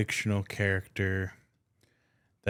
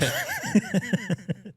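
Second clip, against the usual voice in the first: squealing laugh; laughing high and hard. Real, not acted. Laughing during the bit.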